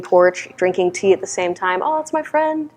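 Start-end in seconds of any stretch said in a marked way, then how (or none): 1.8-2.7 high, small, delighted voice; delighted greeting. Retold or imitated. Spotting a friend over tea on silent retreat, high and delighted. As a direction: softly squeal it, acting out secret recognition.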